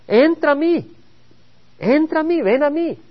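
high, earnest call of the Lord: entra a mí; the Lord inviting. Acted. Preacher voicing the Lord's invitation to the congregation, high pleading call. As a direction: voice the Lord calling the weary in, pitched well up.

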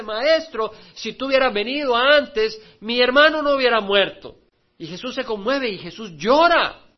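loud, impassioned sermon delivery; full-force preaching. Acted. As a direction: pour it out over the listeners. Predicación a plena voz. (predicación apasionada a toda voz)

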